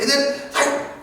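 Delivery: ordinary talk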